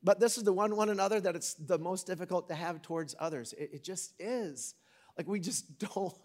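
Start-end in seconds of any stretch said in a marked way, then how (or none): none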